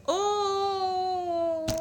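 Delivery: high outraged oh then laughter; gasping in disbelief before laughing; shocked cry and laugh. Real, not acted. Outraged at the banker's claim. (cri indigné puis rire)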